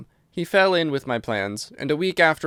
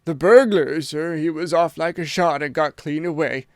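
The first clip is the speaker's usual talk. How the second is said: shaken butler; 2 semitones higher, 3 dB louder than usual. A narrator's reading. Butler still reporting, shaken.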